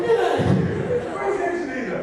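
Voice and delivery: high, loud mock dismissal; comic outraged shout. Dreamer finding the Queen instead of Angelina, comic outraged shout. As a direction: shoo the intruder away, hammed-up.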